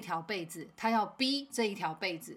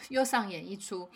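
normal talking voice

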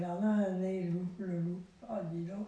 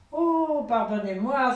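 loud, high apologetic exclamation; exclaiming; 4 semitones higher, 8 dB louder than usual. Acted. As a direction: blurt out the apology. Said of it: "s'exclama Gros Louis".

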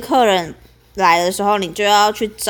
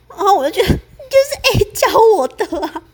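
whiny inner protest; 12 semitones higher, 3 dB louder than usual. Retold or imitated.

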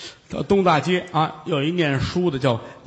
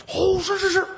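servile clerk; 14 semitones higher than usual. Acted. Clerk answering the monk.

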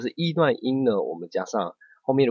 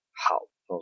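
ordinary delivery

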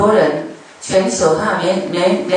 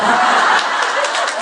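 breathy laugh; 5 semitones higher than usual. Real, not acted. Laughs at own joke.

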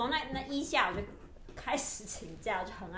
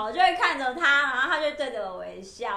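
ordinary talk

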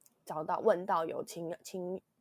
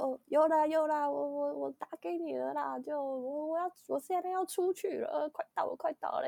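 put-on much higher, hurried old uncle voice; local old uncle; 9 semitones higher than usual. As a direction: play a local uncle answering a call over the wind. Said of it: "阿伯的那种声音". (模仿在地阿伯，声音高很多)

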